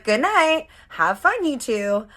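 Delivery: high, loud sing-song goodbye; passive aggressive. Retold or imitated. Leaving the boyfriend's closet with a passive aggressive goodbye. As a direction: sweetly fake farewell with a bite. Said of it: "I am not usually a passive aggressive girl".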